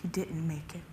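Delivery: very soft, near-whispered; grave hush with long pauses; hushed bad news. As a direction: deliver the death news barely aloud. Officer breaks news of death.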